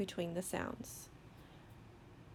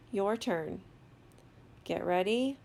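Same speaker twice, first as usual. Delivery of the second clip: normal talking voice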